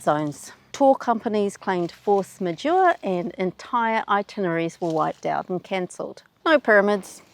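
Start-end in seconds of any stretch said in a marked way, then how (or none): none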